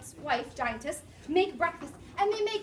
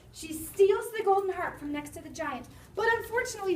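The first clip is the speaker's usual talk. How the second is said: usual speaking voice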